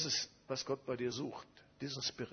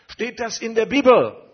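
high, loud, eager question; excited question; 9 semitones higher, 21 dB louder than usual. Retold or imitated. The excited pastors asking whether it is in the Bible, loudly and high. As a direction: ask it like a thrilled pastor.

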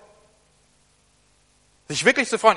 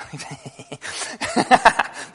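breathy laugh; 3 semitones lower, 4 dB louder than usual. Real, not acted. Laughs while closing something.

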